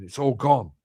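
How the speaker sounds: usual speaking voice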